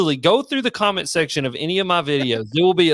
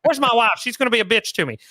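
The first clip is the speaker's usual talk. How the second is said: higher-pitched mock quarterback complaining about the refs; Patrick Mahomes impression; 8 semitones higher than usual. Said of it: "if I talk like this".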